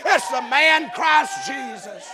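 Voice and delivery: loud, driving sermon cadence; full-force preaching. Real, not acted. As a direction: preach it out to the whole church.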